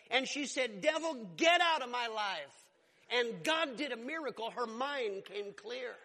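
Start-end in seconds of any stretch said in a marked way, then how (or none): 0.8-2.7 retold forceful rebuke, louder; crying out. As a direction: order the devil out, as if reliving her cry. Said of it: "she cried out to God".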